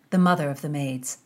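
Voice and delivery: ordinary delivery, nothing marked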